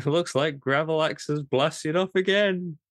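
laughing cartoon villain catchphrase; mock Team Rocket. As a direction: cackle it out like Team Rocket blasting off.

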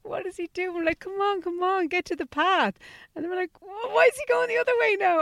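high, eager urging at the little mouse; excited mouse watching. Retold or imitated. In the car with Ella, high excited urging. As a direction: cheer the mouse across the road.

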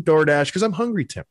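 usual speaking voice

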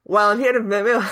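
attempted Cockney email read; Cockney accent. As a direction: pick the Cockney voice back up. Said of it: "do it in a Cockney accent".

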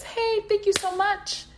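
mock a cheery automated screen, high; chirpy pop-up message. Reading the intake screen's message to therapists, high and chirpy.

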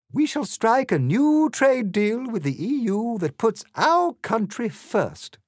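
high, loud grandiose queen voice; haughty Queen. A narrator's reading. Queen boasts to Alice about her reign, grandiose. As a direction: proclaim like a self-satisfied monarch.

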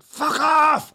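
very loud, high roared shout; Logan Roy. Retold or imitated. Retelling the goalkeeper's shout at the match, explosive roar. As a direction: bellow it out with full force. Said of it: "It was in Logan Roy".

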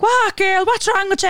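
high-pitched mock-manic retort; manic friend. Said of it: "he's fucking manic".

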